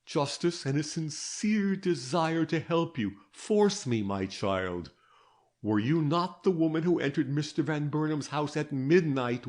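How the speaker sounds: a hint of a patient interrogator; detective Gryce. A narrator's reading. Detective questions the girl.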